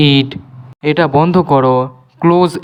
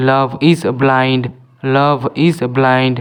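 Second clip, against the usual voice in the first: slow model reading; much slower than usual. Shown as a demonstration. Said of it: "teacher models the sentence slowly".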